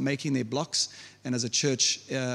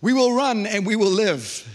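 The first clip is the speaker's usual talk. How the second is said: a soldier's louder, higher cry to flee; loud retold battlefield call to run; retold shout; 5 semitones higher, 7 dB louder than usual. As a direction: shout it like a frightened man in the crowd. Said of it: "the one guy shouts".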